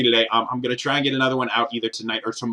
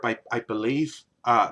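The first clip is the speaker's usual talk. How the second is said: ordinary talk, nothing marked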